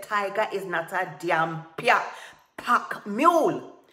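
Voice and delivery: very loud, dragged-out final shout; blind rage. A narrator's reading. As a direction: roar it indignantly, stretching the last words. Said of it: "blind with rage".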